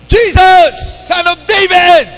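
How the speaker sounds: high, loud desperate cry; blind man's shout. Acted. Blind man calls to Jesus, a loud high shout. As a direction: cry out across the crowd. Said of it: "Pagkatapos niya sumigaw".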